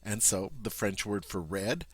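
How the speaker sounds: ordinary talk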